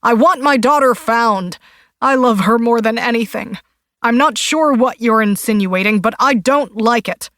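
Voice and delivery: loud, high, offended outburst; a father lashing out at a suspicious detective; insulted husband shouting at the detective; angry distraught father. A narrator's reading. Foster's angry protest.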